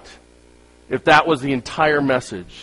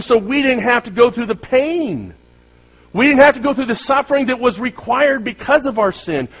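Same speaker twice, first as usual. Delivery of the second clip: fervent preaching; 11 semitones higher, 6 dB louder than usual. Acted. Preaching at full force.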